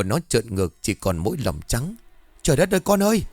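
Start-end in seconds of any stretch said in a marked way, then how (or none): 2.4-3.2 high, panicked cry to her son; mother's horrified cry at her son's bed. A narrator's reading. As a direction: cry out in horror, pitch leaping up. Mother sees son's eyes rolled.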